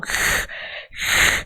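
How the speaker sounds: raspy hiss like a cornered cat; angry cat hiss. Shown as a demonstration. Teacher imitates a cornered cat for the J sound. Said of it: "você vai fazer esse som".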